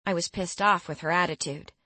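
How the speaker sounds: ordinary delivery, nothing marked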